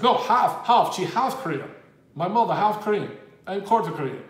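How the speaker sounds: imitated Russian accent, broken English; Gennady Golovkin impression. Golovkin correcting a writer about his mother, imitated Russian accent. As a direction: correct them emphatically in a mock Russian accent. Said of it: "My impression's awful".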